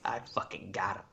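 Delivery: hushed, voiceless mock gloat; mock sinister whisper. Co-host joins the joke of tracking down Cody, mock whisper. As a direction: whisper it like a playful stalker.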